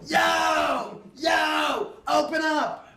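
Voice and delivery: very high shouted calls; hammed-up banging shout; mock yelling at door. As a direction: pound on the door and yell. Hack banging on bathroom door.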